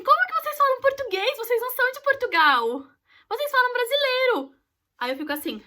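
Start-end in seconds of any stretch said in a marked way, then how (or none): 0.0-4.5 much higher and louder clueless foreigner; incredulous question. Retold or imitated. Foreigner asking why Brazilians speak Portuguese, mocking disbelief. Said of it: "me pergunta assim".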